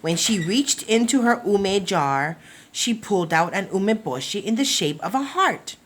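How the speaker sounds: plain reading voice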